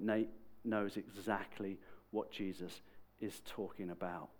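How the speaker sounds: ordinary delivery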